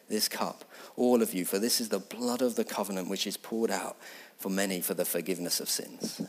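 gentle, intimate words of institution; tender communion voice. Acted. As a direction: hush the room for communion.

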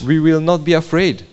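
high, loud, fervent yes to the Lord; eager crowd vow. Acted. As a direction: cry it out like a whole crowd swearing loyalty. Interpreter echoes people's vow.

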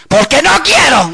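high, slow mock-angry retort; stubborn husband. Preacher voicing husband answering his wife, mock stubborn anger. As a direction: imitate a husband refusing, stretched out.

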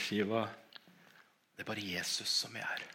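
soft, quiet reassurance to Shiva; softly telling the deaf dog only Jesus is here; hushed words to the dog. Retold or imitated. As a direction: say it gently and low, almost to yourself. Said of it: "Så sier jeg til henne".

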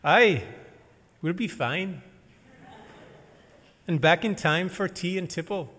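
loud, high put-on Scottish brogue; Scottish accent. Guide William tells the preacher they'll be fine, Scottish accent. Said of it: "Watch out, here comes an accent".

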